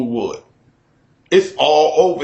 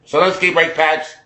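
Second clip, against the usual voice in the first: Dan Aykroyd. Acted. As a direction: do the movie dealer's Chicago vowels.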